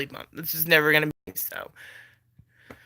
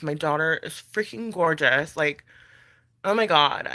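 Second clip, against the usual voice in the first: ordinary talk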